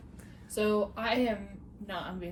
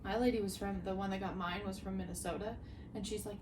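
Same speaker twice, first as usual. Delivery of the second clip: normal talking voice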